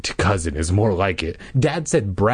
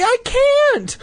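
panicked squeal; 4 dB louder than usual. Acted. Trapped sister answers in panic.

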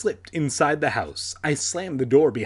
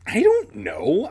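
higher, louder stutter on the first word; halting, panicky start; stammering; 6 semitones higher, 5 dB louder than usual. A narrator's reading. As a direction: stammer it out helplessly. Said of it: "I stammered".

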